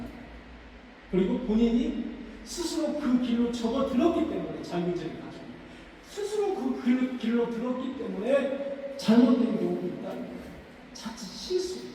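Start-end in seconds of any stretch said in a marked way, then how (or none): none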